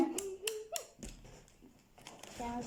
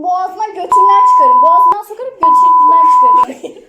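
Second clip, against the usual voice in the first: mock threat shout; 16 dB louder than usual. Threatening friend to eat.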